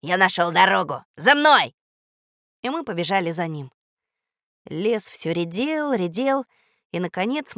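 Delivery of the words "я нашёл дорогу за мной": loud commanding calls; rousing command. Acted. Dog rouses the resting children, commanding. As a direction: bark out orders, lifting the key words.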